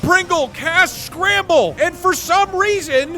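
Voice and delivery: high, slow suspenseful narration; game master's scene setting. Acted. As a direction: stretch out the spell's surprise.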